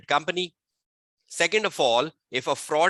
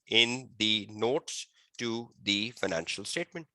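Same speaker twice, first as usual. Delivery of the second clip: slow, softer repeat, phrase by phrase; slow dictation; 4 semitones lower, 5 dB quieter, slower than usual. Real, not acted. Teacher repeating a definition for students, slow and measured. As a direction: repeat it slowly for note-taking.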